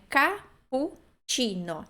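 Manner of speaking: Cappuccino drawn out, ka-poo-chee-no; careful teacher demo; slow model word. Shown as a demonstration. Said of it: "O ti, novamente".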